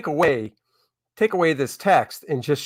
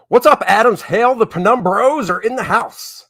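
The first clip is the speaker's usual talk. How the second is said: loud, high, hyped-up shout-out; shouted greeting; 8 semitones higher, 6 dB louder than usual. Real, not acted. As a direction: call out a big hello to the fans. Shouted greeting to arriving viewers.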